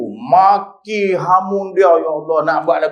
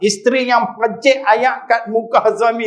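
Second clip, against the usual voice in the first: ordinary delivery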